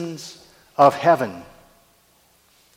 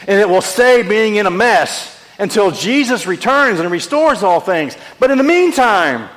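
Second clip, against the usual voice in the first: full force preaching; 5 semitones higher, 5 dB louder than usual. Acted.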